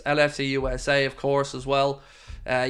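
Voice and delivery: usual speaking voice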